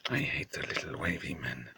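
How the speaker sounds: whispered grudge. Acted. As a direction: whisper it like a dark secret.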